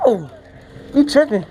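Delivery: ordinary delivery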